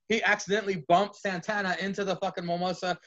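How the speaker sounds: ordinary talk, nothing marked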